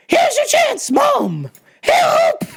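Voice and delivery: high-pitched cry for help to mom; desperate plea. Retold or imitated. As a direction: yell it like a frantic, hammed-up SOS.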